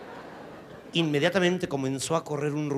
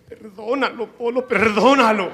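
high, pleading mock plea to forgive; hammed-up anxious lady begging; 9 semitones higher, 9 dB louder than usual. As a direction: beg him with a giggle. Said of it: "Me dice".

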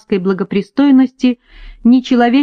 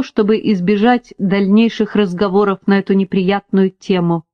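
usual speaking voice

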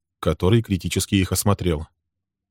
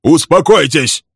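slow, loud barked order; Bill stepping between the brawlers, barking; 9 semitones higher, 8 dB louder, slower than usual. A narrator's reading. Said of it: "рявкнул Билл".

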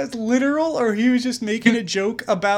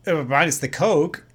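usual speaking voice

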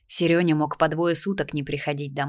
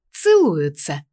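sarcastic; 5 dB louder, slower than usual. Acted. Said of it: "язвительно ответила вместо меня матушка".

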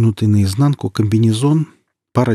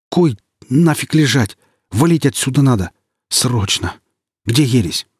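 loud, higher urgent protest; urgent panicked hero; 4 semitones higher, 3 dB louder than usual. Acted. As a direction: press to get out now. Hero urgently wants to flee.

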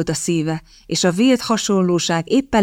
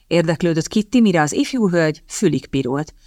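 usual speaking voice

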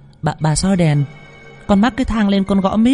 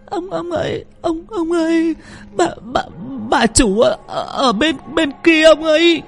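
high stuttering frightened voice; terrified stammer. A narrator's reading. Girl Thúy points at the tree for master, high terrified stammer. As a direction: stammer it out, shaking with fear. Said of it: "tay run run chỉ lên cây mít".

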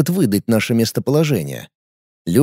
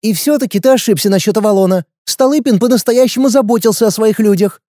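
put-on girl voice; 7 semitones higher than usual. Girl voice, higher.

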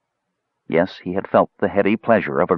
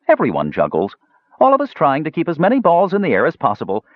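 lightly voiced dwarf, higher-pitched patter; philosophising dwarf showman; 7 semitones higher than usual. A narrator's reading. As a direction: hold forth like a showman mid-act. Dwarf philosophises while juggling.

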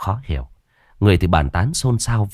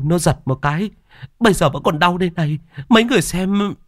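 much higher, distressed woman's voice; frightened, insistent wife; 12 semitones higher than usual. Acted. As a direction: insist shakenly on what you saw, stumbling over words.

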